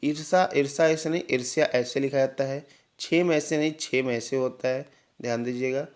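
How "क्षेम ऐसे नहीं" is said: normal talking voice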